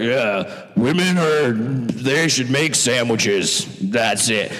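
low, slow mocking dumb-guy voice; low, dragging mock chauvinist; mock sexist guy. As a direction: drag it out like a caveman. Mocking a sexist man's view.

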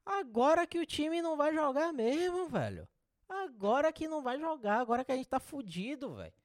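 high, strained exasperated rant. Real, not acted. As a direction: vent your frustration at full pitch. Venting about the team.

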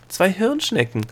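high, delighted call; sudden exclamation. A narrator's reading. Girl showing the gnome two brain snails, exclaiming. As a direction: point it out in excited surprise. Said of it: "rief Delia plötzlich".

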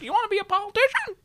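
very high, quick put-on question; imitated lady. Lady quizzing him at a political event, high imitation. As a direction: mimic an overfamiliar lady in a squeaky-high voice.

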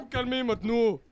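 loud command to calm down; forceful mock-official order; calling to order. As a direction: raise the voice over the squabble. Officer calls the room to order.

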